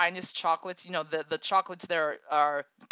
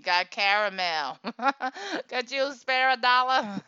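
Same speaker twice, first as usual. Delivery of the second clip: a bit higher, polite sales pitch; lightly voiced kid selling candy; 6 semitones higher, 5 dB louder than usual. Kid asking shoppers to buy chocolate, mild pitch. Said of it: "they say".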